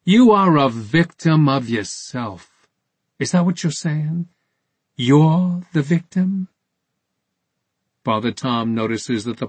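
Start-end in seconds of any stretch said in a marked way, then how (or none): 0.1-6.5 higher, incredulous challenge; scornful disbelief. A narrator's reading. Interrogator answering the priest's self-pity, with incredulous scorn. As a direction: throw it back as an outraged question. Accuser throws the defence back, scornful.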